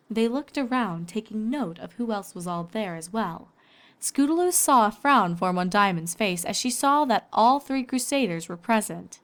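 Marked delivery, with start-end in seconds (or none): none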